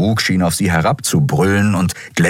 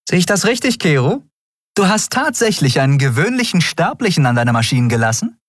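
much higher woman's voice; captain Endriel, teasing; 11 semitones higher, faster than usual. A narrator's reading. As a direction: tease the gruff mechanic. Endriel teases Kero. (hohe Frauenstimme, neckend)

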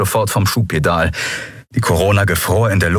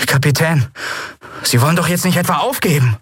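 higher, quick incredulous plea; disappointed appeal; 9 semitones higher, faster than usual. A narrator's reading. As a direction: appeal to the captain in mild dismay. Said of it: "Miko sah sie enttäuscht an". (enttäuscht, mit höherer Stimme drängend)

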